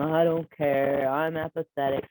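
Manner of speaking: dragged-out apathetic mumbling tone; apathetic drone. Shown as a demonstration. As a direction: drone along as if you don't care. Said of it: "might drone along".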